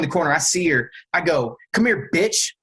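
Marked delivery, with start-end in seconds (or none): 1.7-2.5 higher-pitched mock takedown order; retold tough command. Storyteller reenacts cornering the fleeing suspect, commanding. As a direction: call out a command like an impromptu cop. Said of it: "it just came out this way".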